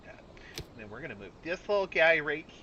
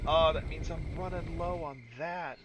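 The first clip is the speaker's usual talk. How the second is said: ordinary talk, nothing marked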